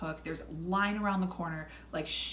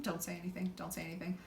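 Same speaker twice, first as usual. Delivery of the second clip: hushed, softer self-talk; a muttered warning to herself, stoned and nervous; inner voice; 8 dB quieter than usual. Retold or imitated. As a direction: quietly tell yourself to stay out of it. Talking herself down, high.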